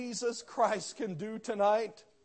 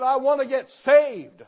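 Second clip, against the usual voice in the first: high, loud eager cry; eager plea; 6 semitones higher, 12 dB louder than usual. Retold or imitated. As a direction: burst out wanting salvation. Convert asks to be saved.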